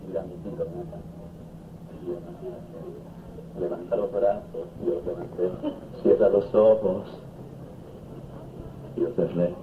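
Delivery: tender voice of God. Acted. God speaks tenderly to him.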